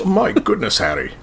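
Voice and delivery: a quick Harry Potter style English line; a touch of Hogwarts English accent. Shown as a demonstration. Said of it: "I can do the Harry Potter ones a little bit".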